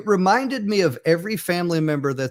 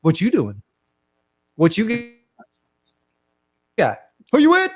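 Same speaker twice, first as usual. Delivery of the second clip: mock interrogation, jumping higher by the end; lightly acted suspicious family challenge; confronting relative; 5 semitones higher, 3 dB louder than usual. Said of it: "straight in the face and I say this right here".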